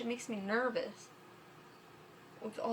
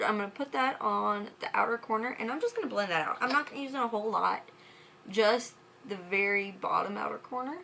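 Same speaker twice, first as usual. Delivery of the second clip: ordinary talk, nothing marked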